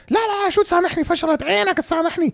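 high-pitched hysterical caricature; woman going crazy. Retold or imitated. Woman refusing to be forgiven, going crazy in high mock voice. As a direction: squeal it as a mock-crazed woman. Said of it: "She is going crazy".

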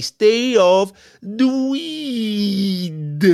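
very slow, drawn-out lecturing voice; Stephen A Smith. Retold or imitated. Stephen A lecturing Paul, very slow and far-off. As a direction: stretch it out like a preachy pundit. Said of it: "Stephen A Smith gonna be like".

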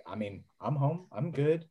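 normal talking voice

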